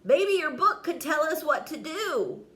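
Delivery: excited idea. Acted. Crispin has idea.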